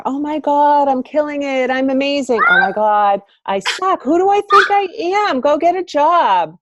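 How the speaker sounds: high, loud, hammed-up swings from bragging to panic; overexcited self-talk that flips to self-mockery; mock entrepreneur highs and lows. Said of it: "the roller coaster of being an entrepreneur".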